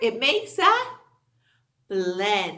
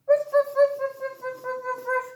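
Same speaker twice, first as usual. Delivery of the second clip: high playful barking; 12 semitones higher, much faster than usual. Acted. Teacher barks like the puppies on the book cover. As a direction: bark "ruff" high and bouncy like puppies. Look at the doggies.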